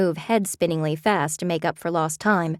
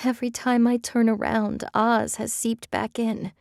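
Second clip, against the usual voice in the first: tearful; 4 semitones higher than usual. A narrator's reading. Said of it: "She let out a shuddery breath".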